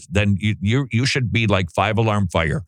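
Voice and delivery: usual speaking voice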